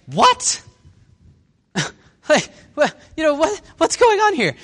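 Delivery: high, loud, sputtering bafflement; hammed-up affronted reaction; indignant disbelief. Peter hearing himself called a kid, acting indignant disbelief. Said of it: "they're frustrated and annoyed".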